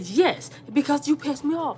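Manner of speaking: high, heated mock retort; retold yelling. She insists she is right, high mock yelling. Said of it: "I'm yelling".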